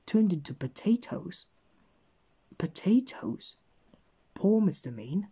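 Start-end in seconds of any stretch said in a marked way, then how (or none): none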